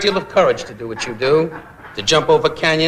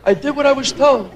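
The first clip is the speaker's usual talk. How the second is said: [name] mumble; 9 semitones higher than usual. Retold or imitated. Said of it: "he's in Salerno going".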